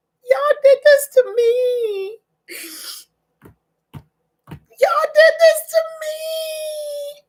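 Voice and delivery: overwhelmed sobbing; crying. Real, not acted. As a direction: wail it out tearfully. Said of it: "I'm crying right now".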